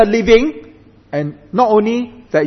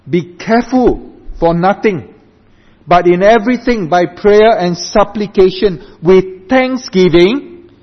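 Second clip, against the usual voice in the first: emphatic scripture reading; 4 semitones higher, 5 dB louder than usual. Acted. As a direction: proclaim the verse to the congregation. Reads scripture aloud while preaching.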